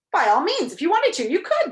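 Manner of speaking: high, loud, eager agreeing; emphatic agreement. A narrator's reading. Humbug switching sides to back Milo, loud eager agreement. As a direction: agree heartily at full voice. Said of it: "the humbug agreed".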